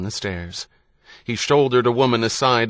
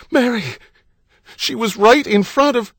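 high, loud desperate cry; frantic calling out; 14 semitones higher, 7 dB louder than usual. A narrator's reading. Husband held back, calling his wife, frantic. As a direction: cry out for her, breaking off mid-sentence.

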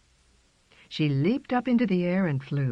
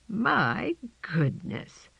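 amazed; 4 semitones higher, much slower than usual. A narrator's reading. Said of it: "said Sarah Wolf".

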